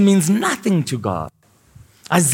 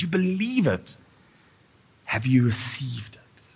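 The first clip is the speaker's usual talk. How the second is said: soft unvoiced intimate question; hushed challenge to the congregation; whisper; 4 semitones lower, 9 dB quieter than usual. Real, not acted. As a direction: lean in and whisper it. Preacher drops to whisper.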